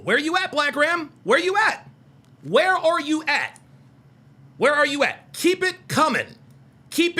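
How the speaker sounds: taunting call into the chat. Real, not acted. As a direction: shout the challenge up high. Calling out to a chatter.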